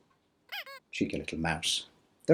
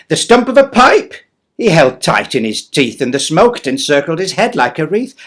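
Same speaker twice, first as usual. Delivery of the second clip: louder lively reading; storybook narration; 6 semitones higher, 13 dB louder than usual. Acted. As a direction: describe Santa to children. Poem narration.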